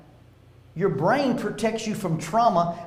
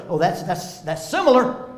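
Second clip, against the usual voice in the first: brain recognizing danger; 6 dB louder than usual. Pretend. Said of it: "when your brain catches those signals".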